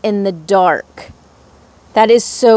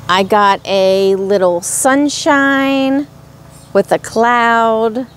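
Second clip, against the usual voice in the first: normal talking voice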